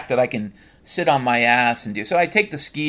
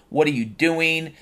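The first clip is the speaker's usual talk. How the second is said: ordinary talk, nothing marked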